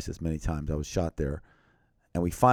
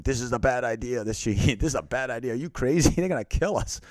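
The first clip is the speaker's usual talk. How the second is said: higher, half-laughing alarm, voicing the nervous translator; panicked translator; 5 semitones higher than usual. Translator warns the soldier walking into the crowd, panicky and high. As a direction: retell his frantic protest with nervous laughs. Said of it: "my translator who's going".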